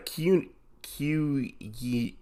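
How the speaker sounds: usual speaking voice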